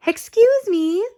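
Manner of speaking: put-on high, bright old lady; brightly. A narrator's reading. Kind old lady at the door, bright and high. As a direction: chirp it brightly like a sweet granny. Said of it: "she said brightly".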